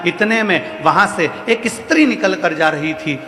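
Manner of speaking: ordinary talk, nothing marked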